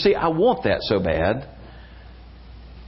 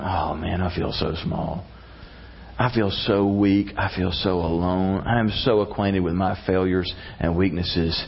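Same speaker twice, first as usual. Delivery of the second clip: small and weak; 7 semitones lower, 3 dB quieter than usual. Pretend. Voicing our small, weak selves.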